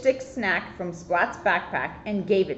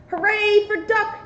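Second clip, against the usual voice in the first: high, bright hooray; cheering; 12 semitones higher, 3 dB louder than usual. Acted. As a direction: cheer it out for the duck. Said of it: "cheered the helpful cats".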